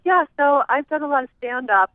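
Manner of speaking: tossing it off the way the comedian did; Jon Lovitz impression. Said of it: "He's like".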